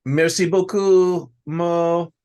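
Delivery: slow, hammed-up French accent; playful French accent thanks; mock French.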